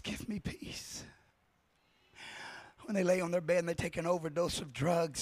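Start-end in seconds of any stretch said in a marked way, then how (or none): 0.0-1.0 slow breathy whisper; hushed desperate whisper; whispered plea. Acted. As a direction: let the plea barely escape. Unvoiced plea of the suffering.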